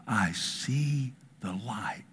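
slow, breathed-out whisper; hushed awe. Retold or imitated. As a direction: whisper it very slowly, as a dying man's last words. Dying man's last words retold.

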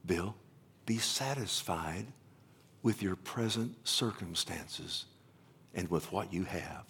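low, soft and slow scripture voice; quiet, gentle admonition. Acted. God answers Bill through scripture, quiet admonition. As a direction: deliver the verse softly and deliberately. God's word voiced to himself.

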